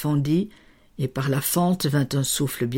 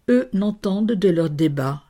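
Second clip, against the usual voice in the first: usual speaking voice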